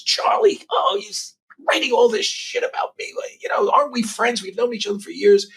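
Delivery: high, lightly mimicked whiny Trump voice; Trump impression. Donald complains to the host about his critical articles, in a lightly mimicked high whiny voice. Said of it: "he goes".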